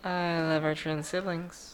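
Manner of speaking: soft, slow and sincere; tender. Real, not acted. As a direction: say it gently, meaning it. Sign-off catchphrase.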